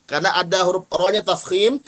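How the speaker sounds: ordinary talk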